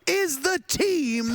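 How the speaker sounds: high, slow mocking echo of partner. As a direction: parrot him in a high voice.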